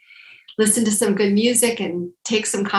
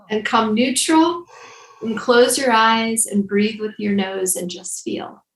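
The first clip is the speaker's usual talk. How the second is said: guided breathing instructions; 3 semitones higher than usual. Acted. Leads breathing exercise.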